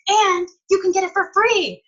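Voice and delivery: high-pitched, re-enacted sales hook; excited pitch. Seller tempting a stranger to join, excited. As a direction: sell the freebie in an excited high voice.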